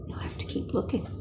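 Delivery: fast whispered aside; hushed encouragement. Real, not acted. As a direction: whisper it to the little listener. Aside to the child.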